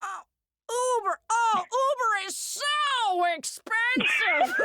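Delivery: high, drawn-out mock horror; Frank. Frank balking at Uber costs, high slow mock dismay. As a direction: wail it as a comic caricature.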